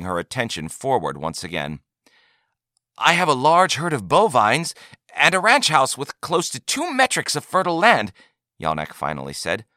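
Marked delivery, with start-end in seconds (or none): 3.0-8.1 high, pressing offer; eager buyer. A narrator's reading. Buyer listing his assets to trader, high and eager. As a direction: push the offer forward, pitched well up.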